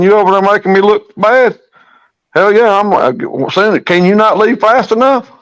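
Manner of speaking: half-joking, chase him down the road; mock shooing off. Mock shooing rival guide away.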